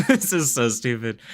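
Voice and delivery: giggling through the words; laughing. Real, not acted. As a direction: laugh at how dumb it is. Laughing at team.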